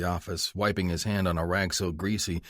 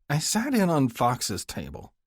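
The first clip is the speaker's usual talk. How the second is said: high, slow nervous confession; 7 semitones higher than usual. Acted. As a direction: confess hesitantly.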